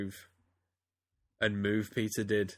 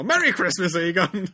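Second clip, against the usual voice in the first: high, loud, showy greeting; over the top; 8 semitones higher, 10 dB louder than usual. Shown as a demonstration. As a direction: ham it up with pizzazz. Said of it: "too much kind of pizzazz".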